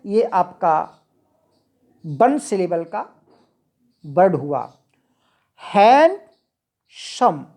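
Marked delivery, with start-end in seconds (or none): 5.6-7.4 "हैंडसम" very slow, split as हैंड, then सम; two-syllable demo. Shown as a demonstration. Teacher showing students two vowel sounds, "हैंडसम" slowly split in two. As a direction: stretch "हैंडसम" into two separate beats. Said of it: "इसमें दो vowel sound हैं".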